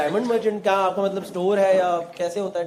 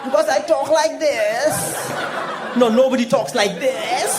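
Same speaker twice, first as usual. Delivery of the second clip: very slow, drawn-out mock English accent; hammed-up viral-video accent; viral reel accent; 2 semitones higher, much slower than usual. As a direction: mimic the reel's accent, stretching every word. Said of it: "आपने वो Insta पे reel देखी".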